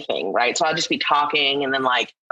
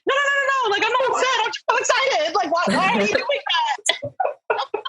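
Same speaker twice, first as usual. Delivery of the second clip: high-pitched, laughing insistence that she's only excited; excited protest. Retold or imitated. Telling people who think she's upset that she's just excited, in a high laughing squeal. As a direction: squeal it out, laughing at their alarm. Acting out reply to alarmed people.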